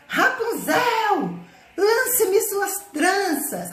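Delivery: slow, high, drawn-out call up the tower; witch calling up. Acted. Witch calling Rapunzel at the foot of the tower, slow and high. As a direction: stretch it out like a witch calling from below.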